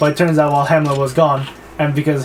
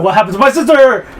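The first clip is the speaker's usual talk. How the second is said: high, loud hammed-up demand; mock distraught outcry; 7 semitones higher, 6 dB louder than usual. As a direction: cry out as the upset brother. Acts the upset brother.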